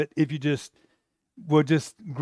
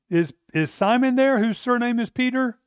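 higher-voiced polite asking; messengers asking; 7 semitones higher than usual. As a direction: call at the door for Simon. Men asking at door.

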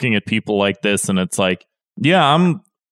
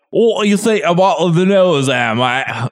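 mock British accent; 6 semitones higher than usual. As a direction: fake a British accent badly. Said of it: "That felt like five accents in one".

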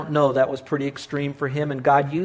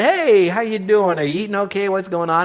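cheery greeting; 5 semitones higher, 4 dB louder than usual. Retold or imitated. Pastor calling a friend in hospital, cheery greeting. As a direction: greet a friend breezily, starting high and loud. Preacher retells his hospital phone call.

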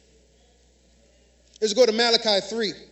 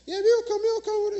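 high eager invitee voice; 10 semitones higher than usual. Retold or imitated. Quoting the invitees' eager reply.

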